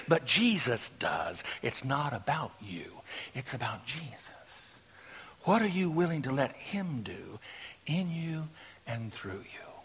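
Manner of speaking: low, soft, solemn pulpit voice; hushed preaching. Real, not acted. Preacher asking dear friends who Jesus is, hushed preaching. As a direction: drop right down and let it sink in.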